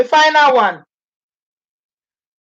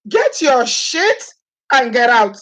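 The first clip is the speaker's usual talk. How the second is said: higher and very slow, dragging out get; high, drawn-out eviction; slower than usual. Shown as a demonstration. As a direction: stretch it out, mocking the eviction.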